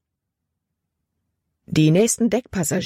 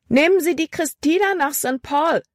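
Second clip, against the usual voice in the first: high-pitched call to a crowd; 11 semitones higher than usual. A narrator's reading. Libby drums up passengers for the Christina, high calling voice. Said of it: "rief sie".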